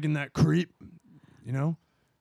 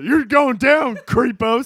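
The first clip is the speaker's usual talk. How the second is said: shouting bust; 11 semitones higher, 15 dB louder, slower than usual. Acted.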